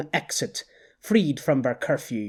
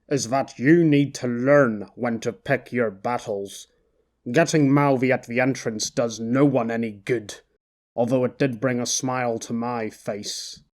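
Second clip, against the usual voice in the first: cool, lower Sonny; 3 semitones lower than usual. Acted. Sonny voiced lower by narrator.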